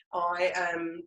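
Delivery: usual speaking voice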